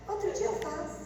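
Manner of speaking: teasing mom put-off. Acted. Mother's teasing reply.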